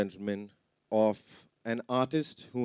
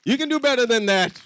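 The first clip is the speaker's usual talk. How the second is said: loud, fast, high call to cheer louder; rally the audience, pushing for more noise; hyping the crowd; 11 semitones higher, 7 dB louder, faster than usual. Real, not acted. Host urging crowd to cheer.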